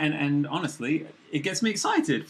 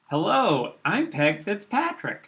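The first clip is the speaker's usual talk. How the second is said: a slow, higher, put-on American accent; an attempt at a posh American accent, said slowly and pitched up; American accent impression of Peg Fitzpatrick; 7 semitones higher, slower than usual. A British host doing a slow, higher American accent on air. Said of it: "That's my best American accent".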